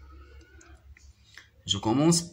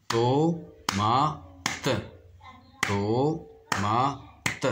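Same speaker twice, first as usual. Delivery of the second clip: "Tomate" as to... ma... te, pauses between; three separate slow beats in "Tomate": to, ma, te; clapped-out syllables; much slower than usual. Shown as a demonstration. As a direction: say "Tomate" beat by beat, final te sounded. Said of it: "on va frapper les syllabes".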